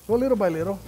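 usual speaking voice

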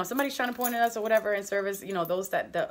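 normal talking voice

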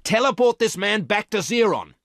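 high, loud furious shout; shouted order. A narrator's reading. Woman shouting orders at the disobedient robot, loud and high. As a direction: yell the command at the robot. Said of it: "shouted the woman".

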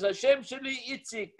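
put-on high, Israeli-accented self-introduction. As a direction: play the classic Israeli guy introducing himself. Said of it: "Classic Israeli guy".

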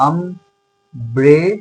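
Umbrella drawn out very slowly, opening আ stretched; slow demo word. Shown as a demonstration. Teacher shows students the আ in umbrella, very slow. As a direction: spell out umbrella slowly so the আ sound is heard. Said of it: "আ দিয়ে হচ্ছে".

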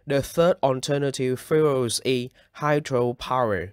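normal talking voice

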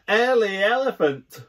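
high, loud teasing shout. Acted. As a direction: shout it across the street. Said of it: "we all shout".

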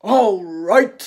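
loud big monster voice; a beast amused at the mouse; laughing Gruffalo. A narrator's reading. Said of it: "bursting with laughter".

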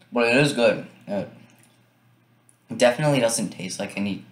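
chocolate in mouth; talking while chewing. Real, not acted. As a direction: mouth partly full. Seen 1: Brings chocolate to mouth near -1 and +0.6, talks while chewing.

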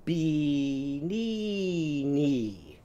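"Be-, knee, knee." with every syllable made an ee; Bee-knee-knee. Shown as a demonstration. Teacher changing banana's vowels to ee for kids. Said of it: "Say it with me".

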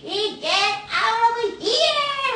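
high, loud, very drawn-out effortful yell; hammed-up grandpa chasing a snake; clumsy angry grandpa. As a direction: heave out each word as if swinging and missing. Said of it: "할아버지 계속 이렇게 못 맞추고 넘어지는".

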